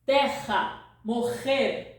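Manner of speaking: terra and morrer with the rr as a throaty H; Portuguese guttural rr in terra and morrer. Shown as a demonstration. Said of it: "It's guttural".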